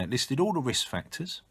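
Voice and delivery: ordinary delivery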